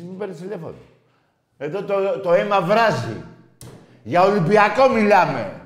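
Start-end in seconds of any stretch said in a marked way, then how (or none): 1.6-5.6 high, loud fired-up cry; impassioned shouting. Real, not acted. Host with boiling blood over Olympiakos, passionate shouting. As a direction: build to a full-voiced shout.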